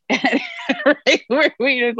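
laughing through the words as the story lands. Real, not acted. To her co-host after the wine list punchline, laughing.

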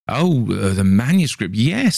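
sudden recall, yes jumping high; light up as he remembers, eager; enthusiastic. A narrator's reading. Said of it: "said Paul enthusiastically".

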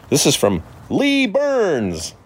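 high, drawn-out announcing yell; yelled-out name, stretched way up. Real, not acted. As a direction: call the name out long and high. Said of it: "Why you're yelling?".

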